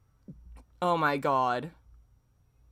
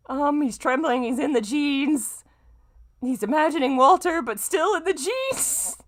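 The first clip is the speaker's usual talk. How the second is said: exasperated; 10 semitones higher, 6 dB louder than usual. Real, not acted. Exasperated running commentary on the text.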